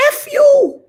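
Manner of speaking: high, loud comic blurt; mock outraged punchline. Streamer revealing the real meaning, loud and high.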